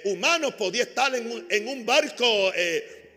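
loud, ringing sermon delivery; full-force preaching. Acted. Pastor preaching loudly to his congregation about Jesus. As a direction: preach it out to the whole church.

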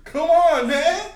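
high, loud protest; loud outraged. Real, not acted. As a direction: yell come on in disbelief. Friends shouting in mock outrage.

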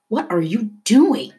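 high, drawn-out indignant demand. A narrator's reading. Leto grabs Poppy by the shirt, demanding. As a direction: demand an answer, slowly and emphatically. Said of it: "he demanded".

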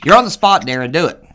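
loud teasing push. Real, not acted. Putting caller on the spot.

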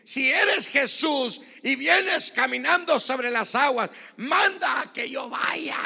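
high, shouted, drawn-out challenge; roaring preacher acting out the disciple; full-force preaching. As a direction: bellow it as bold Peter calling across water. Shouts Peter's challenge to Jesus.

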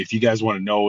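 ordinary talk, nothing marked

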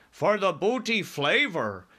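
high, slow interjection; BFG crying out. A narrator's reading. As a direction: chime in with a drawn-out cry. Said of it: "cried the B F G".

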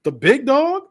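high, sneering question thrown at the crowd; mocking sneer. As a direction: needle them with a high scoff.

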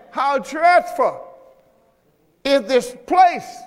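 drawn-out, high scripture reading; slow preaching. Acted. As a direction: stretch every word in awe.

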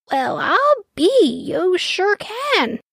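a grandma drawing out her wonder; amazed grandma. Acted. Grandma voiced in story.

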